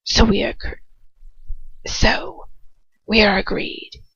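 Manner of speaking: cold hissing whisper of a dark lord; Voldemort whisper. Acted. Voldemort deciding who to send, in a cold whisper.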